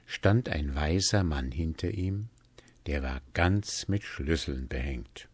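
plain reading voice

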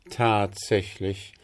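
ordinary delivery

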